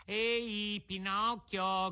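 high-pitched, drawn-out call; acted shout to a boy. A narrator's reading. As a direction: cry out the name, stretching it. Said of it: "gridò allora il più grande".

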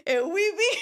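laughing imitation. Kids imitating drunk stutterer.

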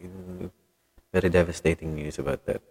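usual speaking voice

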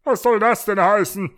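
loud, high, suspicious demand; suspicious rooster officer. A narrator's reading. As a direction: challenge it as a mistrustful rooster cop. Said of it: "fragte der Beamte misstrauisch".

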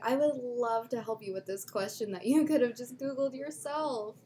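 lightly sugary helpful clerk; a hint of fake politeness at the counter; customer service voice. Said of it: "Wow, that's... That's really good".

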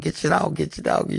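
high, urgent repeated plea; mock panicked cry. Friend chased by the dog, mock panicked cry. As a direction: squeal it as if being chased.

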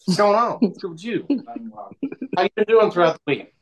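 mock street friend hello; street slang greeting. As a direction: greet your homie loose and easy.

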